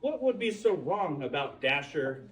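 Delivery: plain reading voice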